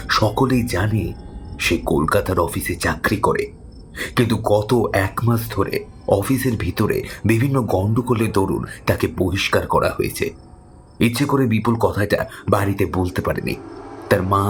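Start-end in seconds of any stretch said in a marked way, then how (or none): none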